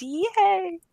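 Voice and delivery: high happy squeal of yay; excited cheer. Real, not acted. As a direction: cheer it out, delighted. Cheering that suggestion worked.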